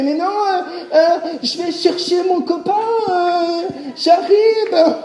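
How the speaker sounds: high, laughing, lightly voiced girl making an excuse; girl's excuse. Girl bluffing to the cashier, high mock-innocent excuse. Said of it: "elle a fait".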